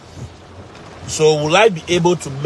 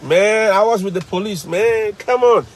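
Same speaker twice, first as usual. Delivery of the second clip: casual student; 6 semitones higher than usual. Retold or imitated. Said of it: "he comes back and says".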